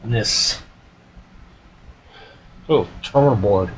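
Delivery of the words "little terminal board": ordinary talk, nothing marked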